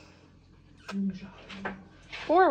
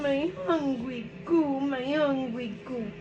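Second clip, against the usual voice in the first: slow, high-pitched cooing; singsong mommy voice, drawn out; 5 semitones higher, 3 dB quieter, slower than usual. Real, not acted. Baby talk to hungry child.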